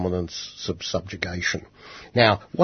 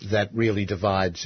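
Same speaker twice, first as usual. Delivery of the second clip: normal talking voice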